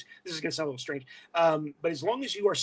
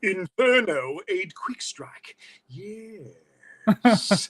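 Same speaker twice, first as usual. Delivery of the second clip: slow, drawn-out villain command; theatrical cartoon warlord, "Yes" long and loud; Beast Wars villain; 5 semitones higher, 6 dB louder, much slower than usual. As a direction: stretch each word with menacing relish. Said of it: "my David Kaye Beast Wars impression".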